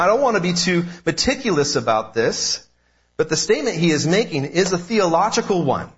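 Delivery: normal talking voice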